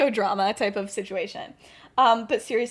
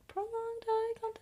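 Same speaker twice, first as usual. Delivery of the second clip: hushed, voiceless song imitation; whispered echo; 10 semitones higher, 13 dB quieter than usual. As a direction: whisper it like a fading refrain. Echoing the song, fading out.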